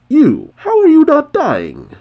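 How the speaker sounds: very high, loud disbelief; shocked. A narrator's reading. Clown to unaffected Happy, shocked questioning. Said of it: "Clown is shocked when he sees Happy".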